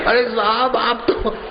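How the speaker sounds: very high, giggling plea to the chief; laughing while hushing. Acted. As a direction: try to hush everyone and keep cracking up. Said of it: "आप चुप कराते हंस रहे हो". (हँसते हुए चुप कराना, ऊँची आवाज़)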